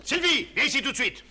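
high, loud commanding call; full name scolding. Acted. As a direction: summon her like a master of the house. Husband summons wife, asserting authority.

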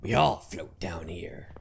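low eerie unvoiced whisper; creepy whisper. Quoting the sewer clown to viewers, in a creepy whisper. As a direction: breathe it like a lurking clown. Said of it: "Is this where Pennywise".

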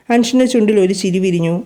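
plain reading voice

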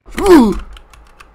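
short high, loud yelp; sudden high shriek; startled gasp. Real, not acted. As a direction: gasp loudly in fright. Startled by fast enemy.

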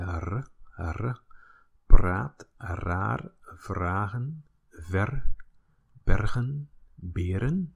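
praat, raar, vragen, ver, bergen, beren with trilled R; rolling R. Shown as a demonstration. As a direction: roll every R from praat to beren. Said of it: "The rolling R".

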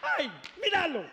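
high, delighted cooing; gushing mother. Retold or imitated. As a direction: coo over the baby proudly. Mother gushing over baby.

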